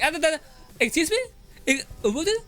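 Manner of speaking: high, mock-scandalized parent voice. To the child, imitating a parent's high mock surprise. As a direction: pitch way up like a shocked parent. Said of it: "your parent was like".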